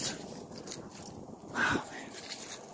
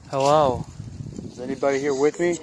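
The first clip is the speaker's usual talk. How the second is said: calling out to spirits; 3 semitones higher, 7 dB louder than usual. Real, not acted.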